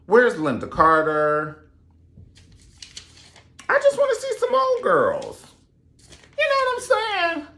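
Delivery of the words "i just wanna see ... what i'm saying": very high, playful whine; high squeaky plea. Real, not acted. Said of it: "own plea".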